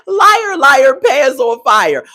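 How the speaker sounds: high, loud, drawn-out taunt; mock accusing yell. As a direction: chant it loudly like a playground accusation. Calls out J K's lie.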